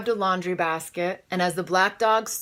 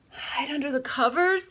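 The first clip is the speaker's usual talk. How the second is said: mild wail; 8 semitones higher than usual. Acted. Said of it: "they all wailed".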